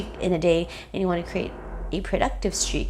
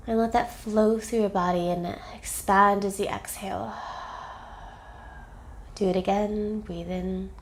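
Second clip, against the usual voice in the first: calm, measured meditation voice, softening at phrase ends; unhurried phrases with space to breathe; guided meditation; 5 dB quieter than usual. Acted. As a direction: guide them gently breath by breath.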